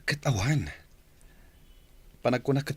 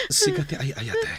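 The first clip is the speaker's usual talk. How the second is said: pleading cry. Acted. Husband begging forgiveness.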